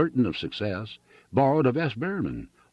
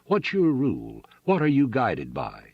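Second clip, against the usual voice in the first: understated sarcastic challenge; furious sarcasm; 5 semitones higher than usual. A narrator's reading. Farmer challenging railroad's rates, furious sarcasm. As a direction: demand it with a touch of fury. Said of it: "vociferated with furious sarcasm".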